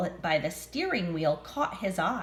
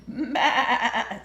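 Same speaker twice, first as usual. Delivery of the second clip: sheep bleat; 4 semitones higher, 4 dB louder than usual. Acted. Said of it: "said Sheep".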